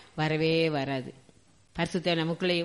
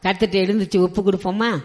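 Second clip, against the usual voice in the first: ordinary talk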